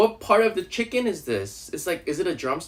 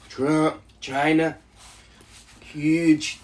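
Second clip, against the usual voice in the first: Trump voice; much slower than usual. Pretend. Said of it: "Do Donald Trump".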